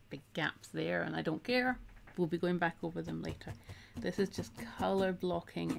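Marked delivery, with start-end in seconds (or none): none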